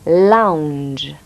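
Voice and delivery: "lounge" with the vowel drawn out as a clear "aou" glide; aou vowel shown. Shown as a demonstration. Teacher isolates the vowel for French learners, careful articulation. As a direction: open "lounge" on a round "aou" sound. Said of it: "On entend aou".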